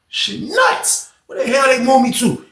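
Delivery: high, startled yelp of surprise; shocked exclamation. Retold or imitated. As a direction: blurt it out in sudden alarm. Narrator voicing his own shocked reaction.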